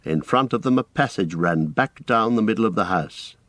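ordinary delivery, nothing marked

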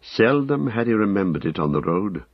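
ordinary delivery, nothing marked

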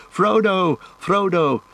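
high-pitched, carrying call; clear high hobbit voices. A narrator's reading. Hobbits shouting through trees for Frodo, high and clear. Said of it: "high hobbit voices".

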